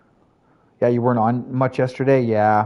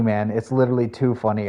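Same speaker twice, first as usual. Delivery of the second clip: normal talking voice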